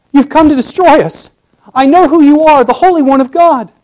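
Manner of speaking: high, loud, falling on destroy; fearful statement. Shown as a demonstration. Demon declares Jesus has come to destroy, terrified. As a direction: state your doom in terror. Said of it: "Could be a statement".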